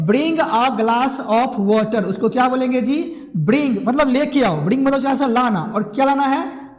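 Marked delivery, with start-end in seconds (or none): 0.1-2.0 slow dictation. Shown as a demonstration. Teacher dictating an order to a student. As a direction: Bring a glass of water said very slowly. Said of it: "उसको बोलेंगे".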